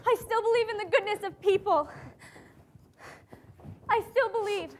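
moved, trembling declaration of faith; tearful affirmation. Acted. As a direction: say it like a vow while crying. Answers, moved, after the cries.

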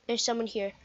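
ordinary talk

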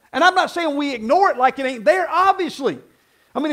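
usual speaking voice

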